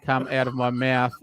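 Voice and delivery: usual speaking voice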